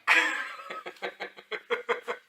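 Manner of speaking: a high, breathy laugh bursting out; high burst of laughter. Real, not acted. Pupil laughing at his own rude poem, high and free.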